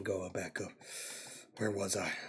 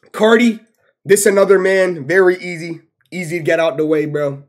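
ordinary talk

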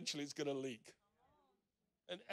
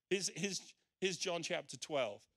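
normal talking voice

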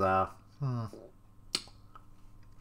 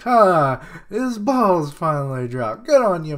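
raised, punchy acted cheer; loud cheering on; 6 semitones higher, 9 dB louder than usual. Luffy cheers Momo on, loud and rowdy. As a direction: crow it like a rowdy pal.

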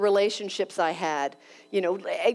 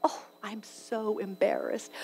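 embarrassed whisper; 8 dB quieter than usual. Real, not acted. Aside during the phone call.

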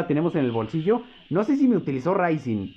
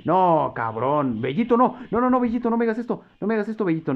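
high, frantic begging; panicked pleading; 5 semitones higher than usual. Real, not acted. Streamer begging his character Bellito to survive, panicked and high. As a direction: plead desperately with the game.

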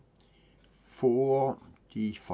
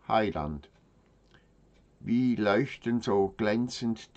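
raised, measured declamation with pauses between phrases; solemn poem recitation; 4 semitones higher, 4 dB louder than usual. Acted. Reading brethren a poem to the Saviour, solemn declamation. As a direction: recite devotional verse to the Saviour, stressing key words firmly. Reads a devotional poem aloud.

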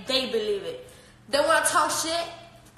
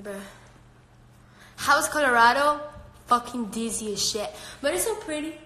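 normal talking voice

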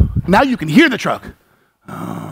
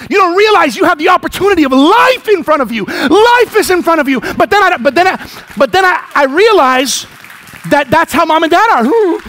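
passionate preaching shout; 5 semitones higher than usual. Real, not acted. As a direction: urge the congregation with fervour. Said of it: "The pastor screams".

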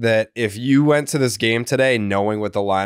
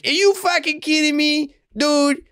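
much higher, hammed-up Boston accent; fake Boston accent. Answering what they sound like with a hammed-up Boston accent. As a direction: overdo a TikTok Boston accent. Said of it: "what all the fucking people on TikTok that pretend to be from Boston sound like".

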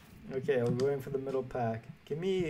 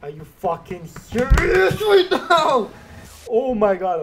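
furious yell; 9 semitones higher, 12 dB louder than usual. Real, not acted. Gamer raging at his pack pull, furious yell. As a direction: scream it at the screen in disbelief.

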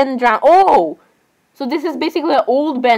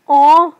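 loud, higher cooing aww; overwhelmed aww; 4 semitones higher, 6 dB louder than usual. Real, not acted. Fangirl whose heart can't take the cuteness, adoring aww. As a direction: let a big "aw" burst out. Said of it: "my heart cannot take this cuteness".

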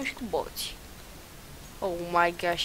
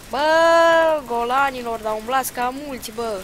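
indignant yell; 4 semitones higher, 8 dB louder, slower than usual. Real, not acted. Yelling at enemy group.

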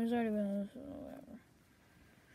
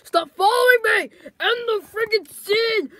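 higher, louder, fed-up yell; furious shout; 8 semitones higher, 10 dB louder than usual. Acted. Fed-up actor yelling at the stalking New York guy. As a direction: scream at him to stop.

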